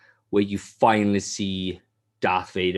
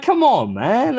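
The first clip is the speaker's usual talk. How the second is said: higher, louder exasperated outburst; exasperated yell; 7 dB louder, slower than usual. Retold or imitated. Fan yelling at the game's commander, exasperated mock shout. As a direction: vent at the useless leader. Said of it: "I'm like".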